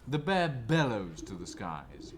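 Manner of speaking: ordinary delivery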